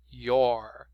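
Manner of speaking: slow you're said like "your", one syllable; pronunciation demo. Shown as a demonstration. Teacher showing students how you are sounds, slow and careful. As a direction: stretch your out slowly so students hear it. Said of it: "Presta atenção na pronúncia que muda".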